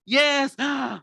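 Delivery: high, excited cheer with a gasp; suddenly happy. Shown as a demonstration. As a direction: burst out happily, pitched well up. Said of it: "you are happy and then you suddenly".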